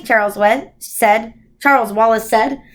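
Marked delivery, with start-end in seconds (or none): none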